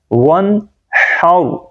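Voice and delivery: "ون ہاؤ" slow with tight throaty h; correct haa, heavy r. Shown as a demonstration. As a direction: separate "ون ہاؤ", rounding the final r. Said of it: "را کو موٹا پڑھیں گے".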